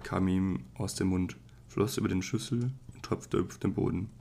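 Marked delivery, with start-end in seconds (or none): none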